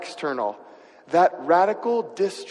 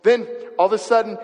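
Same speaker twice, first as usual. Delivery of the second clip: ordinary delivery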